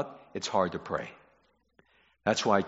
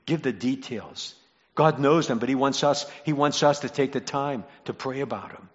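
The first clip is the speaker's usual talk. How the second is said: usual speaking voice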